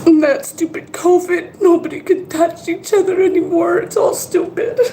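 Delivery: tearful, high-pitched complaint; crying. Real, not acted. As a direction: cry it out, voice cracking. Crying about covid isolation.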